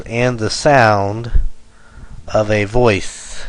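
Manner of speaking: slow, measured beat landing on "sound" and "voice"; anapestic stress. Shown as a demonstration. Teacher giving anapest example, slowly stressing "sound" and "voice". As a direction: lean on "sound" and "voice", keep other words light. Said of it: "two unstressed".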